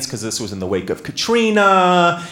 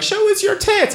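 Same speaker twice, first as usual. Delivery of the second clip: very high mock heckler yell; high-pitched heckling, played for laughs; imitating rowdy hecklers. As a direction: call out like a rowdy crowd member. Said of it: "They were rowdy and yelling shit at us".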